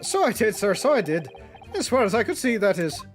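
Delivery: lightly voiced Irish old man; Irish accent.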